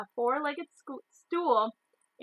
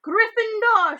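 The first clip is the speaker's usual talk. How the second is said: much higher, loud announcing shout; hat shouts; 11 semitones higher, 8 dB louder, slower than usual. A narrator's reading. The hat announces the house to everyone, shouting. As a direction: bellow the house to the hall. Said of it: "shouted the hat".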